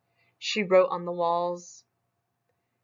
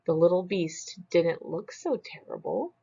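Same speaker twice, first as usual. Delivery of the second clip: ordinary delivery, nothing marked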